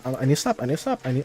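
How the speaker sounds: suddenly soft, low self-check; reining oneself in. Real, not acted. Streamer catching himself after a rant, hushed and sheepish. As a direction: drop the volume and talk yourself down.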